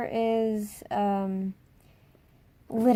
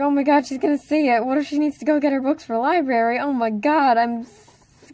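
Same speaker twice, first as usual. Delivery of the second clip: fast, higher and loud panic; retold freak-out; 4 semitones higher, 8 dB louder than usual. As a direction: rattle it off in a panic. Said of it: "freaking out about it to Becky".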